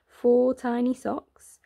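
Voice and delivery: plain reading voice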